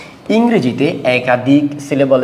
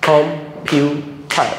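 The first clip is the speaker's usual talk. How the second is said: "Computer" very slowly as কম, পিউ, টার, with gaps between; clap-along "computer", one spaced beat each for কম, পিউ, টার; split into syllables; 2 semitones higher, much slower than usual. Shown as a demonstration. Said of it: "practical এবার practice করতে হবে".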